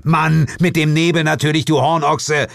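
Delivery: loud, high-pitched scolding; exasperated snort. A narrator's reading. Detective corrects dense partner about the fog, exasperated. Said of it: "Ich schnaubte".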